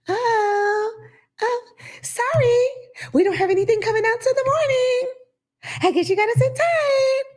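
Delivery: very high, falsetto chirpy agent; gleeful airline agent. Retold or imitated. Mocking the Orlando airline agent, high chirpy glee. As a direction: squeak the bad news sweetly. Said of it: "she was one of these bitches".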